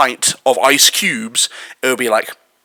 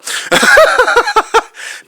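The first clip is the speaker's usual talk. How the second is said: high-pitched laugh; 4 dB louder than usual. Real, not acted. Laughs at the clip.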